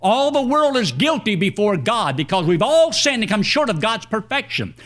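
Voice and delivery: normal talking voice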